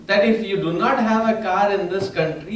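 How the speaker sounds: ordinary talk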